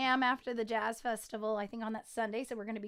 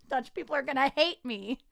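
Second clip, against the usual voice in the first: usual speaking voice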